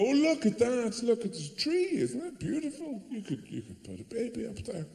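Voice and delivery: high, gushing mock coo; lightly mocking doting voice; cooing woman. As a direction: gush over the tree like a broody woman. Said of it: "a woman would go".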